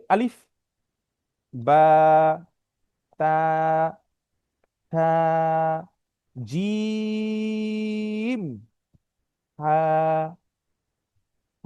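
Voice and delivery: "আলিফ", "বা", "তা", "থা" said one at a time, "জিম" long and high; Arabic letter recitation. Shown as a demonstration. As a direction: model each letter for the class to repeat. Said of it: "আমার সাথে সাথে আপনারা বলবেন". (শিক্ষকের হরফ পাঠ, ধীরে ধীরে)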